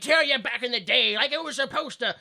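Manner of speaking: mock someone pining for old days; nostalgic old-timer. Old-timer recalls when people looked like their names, mock nostalgia.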